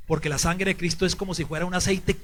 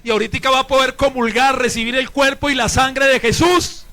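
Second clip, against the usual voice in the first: loud, higher, proclaimed to the congregation; forceful preaching; 6 semitones higher, 9 dB louder than usual. Real, not acted. Priest announcing a brother's forgiveness and communion, forceful preaching. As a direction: proclaim it triumphantly from the pulpit.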